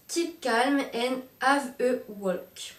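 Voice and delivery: slow English read with a heavy French accent. Real, not acted. Vlogger reading the English text on the leash, slow French-accented English. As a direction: read the English slogan slowly, French accent on every word. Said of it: "désolée pour mon accent magnifique".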